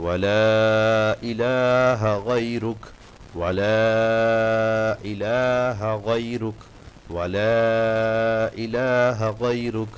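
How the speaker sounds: إله غيرك recited slowly with long vowels; slow, careful repetition; slow recitation demo. Shown as a demonstration. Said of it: "এভাবে আস্তে আস্তে টান দিয়ে দিয়ে". (ধীরে টেনে টেনে)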